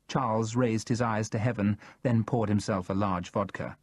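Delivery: ordinary delivery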